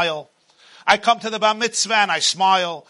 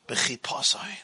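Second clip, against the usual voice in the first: whispered word; 8 semitones lower, 9 dB quieter, slower than usual. Shown as a demonstration. Preacher whispering the word b'chipozoin to the congregation.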